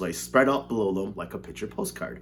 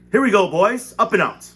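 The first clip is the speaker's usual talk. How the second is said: triumphant cry; 9 semitones higher, 9 dB louder than usual. A narrator's reading. Wonka's triumphant launch.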